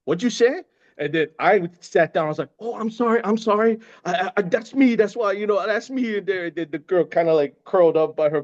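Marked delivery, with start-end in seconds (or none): 0.1-0.6 confrontational. Retold or imitated. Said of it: "She's like". 2.6-6.2 sheepish apology. Retold or imitated. Apologizing sheepishly to angry woman.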